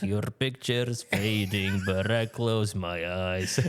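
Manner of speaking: thick mock Russian accent, slow. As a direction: croon it in a hammy Russian accent. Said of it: "with, like, a thick Russian accent".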